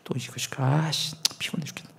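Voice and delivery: whispered sulky complaint under the breath; grumbling whisper. Retold or imitated. Pastor imitating his younger self grumbling under his breath. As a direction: mutter the grumble like a fed-up young assistant. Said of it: "씨부렁 씨부렁 거리는데".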